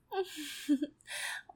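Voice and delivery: light breathy laugh. Acted. Soft laugh in intimate roleplay.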